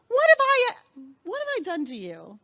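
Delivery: put-on a parent asking a child what they did; exasperated parent. Shown as a demonstration. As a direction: scold like a fed-up parent, then trail off. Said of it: "Now be a parent. Give me a rephrase".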